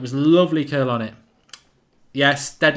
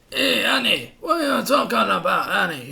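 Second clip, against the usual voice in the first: much higher Italian-ish creature voice; cartoon Italian accent, much higher; Italian alien voice; 6 semitones higher than usual. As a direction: play the flying slave keeper teasing Annie. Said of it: "That's what I was trying to sound like".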